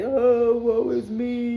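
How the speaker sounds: hammed-up poor-me moan; mock self-pity. Tarot reader mimicking a lonely diner, mock self-pity.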